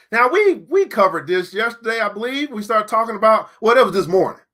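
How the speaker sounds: normal talking voice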